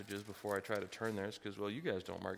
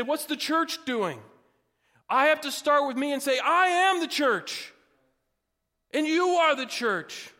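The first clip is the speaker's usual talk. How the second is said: preaching; 9 semitones higher, 9 dB louder than usual. Acted. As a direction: drive the point home to the church. Preaching to the congregation.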